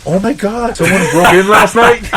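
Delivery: high mock-innocent gasp, laughing; feigned shock. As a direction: play dumb with wide-eyed fake surprise. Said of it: "I was just like".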